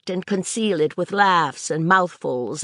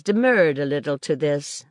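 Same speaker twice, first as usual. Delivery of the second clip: plain reading voice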